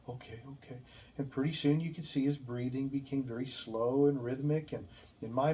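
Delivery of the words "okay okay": much softer, drowsy murmur; soft sleepy okay. Retold or imitated. Patient murmurs to the nurse while settling into bed. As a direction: mumble it as if drifting off. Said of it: "every time he'd say".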